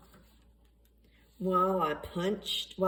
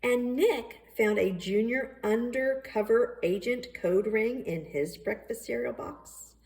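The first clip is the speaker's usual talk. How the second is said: usual speaking voice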